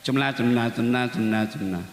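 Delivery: low, gabbled mock recitation; mock-rushed mumble of dhikr. Shown as a demonstration. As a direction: rattle it off like hurried prayer beads. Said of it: "terlalu cepat cara ngucapnya".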